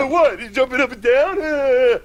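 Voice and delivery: high, laughing disbelief; shocked and amused; incredulous. Retold or imitated.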